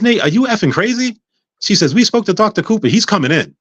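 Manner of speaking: high-pitched imitation of an indignant nurse; exasperated scolding. Head nurse confronts patient refusing surgery, mock exasperation.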